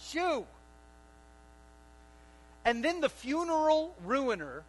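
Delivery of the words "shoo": high, loud go away shouted; Jesus ordering the mourners away, loud; loud command. Retold or imitated. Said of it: "That's what he says".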